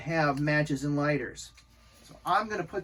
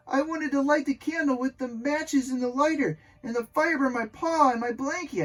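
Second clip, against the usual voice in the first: high, mildly distressed confession; mildly upset cry; 8 semitones higher, 3 dB louder than usual. A narrator's reading. Max telling mother about the burned paw, mildly upset cry. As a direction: raise the pitch, a touch of crying upset. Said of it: "I cried".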